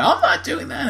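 high-pitched mock protest; squeaky imitation of a balking pal; mimicked refusal. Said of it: "And Ben's like".

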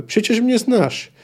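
shouted plea. A narrator's reading. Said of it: "krzyknęła Nimue".

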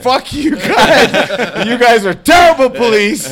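high, drawn-out comic outburst; hammed-up angry shouting; indignant shout. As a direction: yell it at useless police, acting it out. Retold insult shouted at police.

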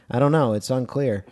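ordinary talk

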